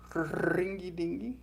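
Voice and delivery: slow, soft rolled ringy dingy; British roll. Shown as a demonstration. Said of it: "I don't have a good British roll".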